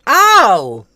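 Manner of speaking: loud, high startled oh; surprised. Real, not acted. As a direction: gasp it out in surprise. Surprised exclamation.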